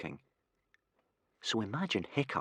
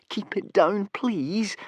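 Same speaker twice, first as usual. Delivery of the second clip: begging; 12 semitones higher, 3 dB louder, much slower than usual. A narrator's reading. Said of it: "begged poor Hiccup".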